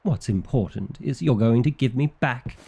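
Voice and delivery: usual speaking voice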